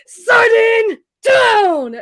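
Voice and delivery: high, loud announcing cry; booming arena call; ring announcer shout. Acted. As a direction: call the result out to the whole arena. Announcing the loser to crowd.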